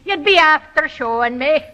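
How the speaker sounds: coach's Irish accent; Irish brogue. Shown as a demonstration. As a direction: demonstrate an Irish brogue for copying. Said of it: "a technical phrase".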